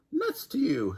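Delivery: high mocking taunt; sing-song a high taunt. A narrator's reading. Nightingale pushing Jackal out, high taunt.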